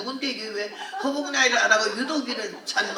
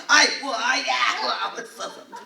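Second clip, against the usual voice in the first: drawn-out laughing aigo, louder then fading; laughing wail; 3 semitones higher, 3 dB louder, slower than usual. Retold or imitated. Speaker recalling her mother's teasing, wailing aigo with laughter. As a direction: groan it half laughing.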